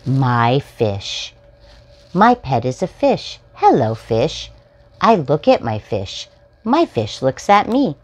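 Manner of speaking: bright, sing-song picture-book reading; reading to children. Acted. Host reading a picture book to young viewers, storytime voice. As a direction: read it out like storytime for little ones.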